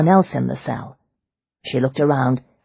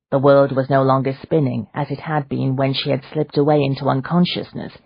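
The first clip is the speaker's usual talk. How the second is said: ordinary delivery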